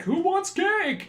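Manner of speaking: high, slow, forced cheer; awkward cheer. Retold or imitated. Billy Crystal's character breaking an awkward silence at his party, forced cheer.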